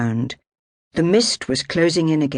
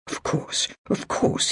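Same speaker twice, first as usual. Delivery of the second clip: soft, breathless near-whisper; placating murmur with a blade at his throat; 4 semitones higher, 5 dB quieter, slower than usual. A narrator's reading. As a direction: murmur it to calm the swordsman. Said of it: "he murmured".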